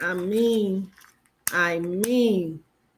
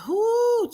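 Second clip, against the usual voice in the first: drawn-out high "whoo"; high whoop; 14 semitones higher than usual. Real, not acted. Flustered reader getting back to the reading, high whoop. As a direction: whoop to shake off a distraction.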